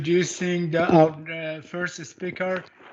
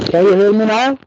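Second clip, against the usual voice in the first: much louder, asking if heard; loud mic check; 3 semitones higher, 12 dB louder, faster than usual. Real, not acted. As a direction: call out into the mic. Mic check after being muted.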